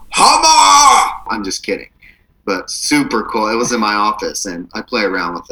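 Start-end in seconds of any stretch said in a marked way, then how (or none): 0.1-1.2 higher, louder, slow mock battle cry; mock shout. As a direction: bellow it like a playful war cry. Mock threat, then "just kidding.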